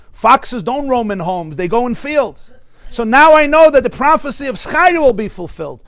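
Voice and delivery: exultant, loud, high-pitched declaration; Rabbi Akiva. Acted. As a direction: build to a triumphant peak, stretching key words. Acts out Akiva's exultant explanation.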